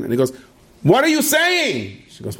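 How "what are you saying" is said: high-pitched drawn-out cry of protest; outraged husband. Retold or imitated. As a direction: cut in, appalled, stretching the words high. Husband interrupts wife's prayer in alarm.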